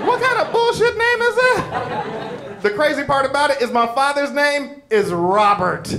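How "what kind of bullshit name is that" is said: much higher, slow, disbelieving; mock incredulous. Stranger hearing the odd name, hammed-up disbelief. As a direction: act out a stunned stranger. Audience's imagined stunned reaction.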